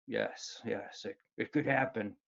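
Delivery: a hint of dad's low, soft nervous murmur; mildly imitating dad's quiet warning; dad's deep voice. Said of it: "my dad had this very deep voice".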